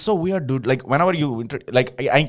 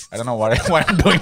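ordinary talk

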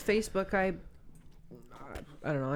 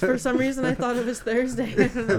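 laughing; 5 semitones higher, 6 dB louder than usual. Real, not acted. Laughing at misheard word.

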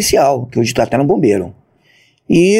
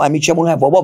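ordinary talk

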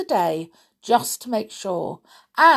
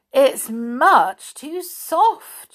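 slow, higher, louder objection; mild complaint; 7 semitones higher, 6 dB louder, much slower than usual. A narrator's reading. Goldilocks trying Mother Bear's bed, displeased. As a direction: stretch it out in dissatisfaction.

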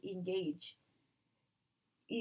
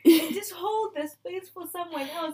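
much louder and higher, insistent; emphatic loud plea; 9 semitones higher, 12 dB louder than usual. Real, not acted. Telling people to hold space, much louder and higher. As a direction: demand they step back.

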